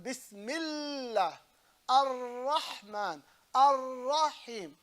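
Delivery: very slow "Bismillah, Ar-Rahman, Ar-Rahim", l and r held long; exaggerated doubled consonants in "Bismillah, Ar-Rahman, Ar-Rahim"; pressure on letters. Shown as a demonstration. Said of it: "that emphasis on a letter".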